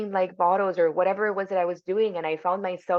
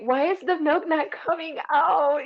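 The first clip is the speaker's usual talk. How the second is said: much higher, baffled mock wail; 新手妈妈对着吸奶器，高声茫然发问; delirious confusion; 10 semitones higher than usual. Delirious new mom at pump.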